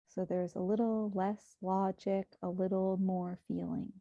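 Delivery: hushed, even meditation guidance; guided meditation. Acted. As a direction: guide listeners gently into breath and body.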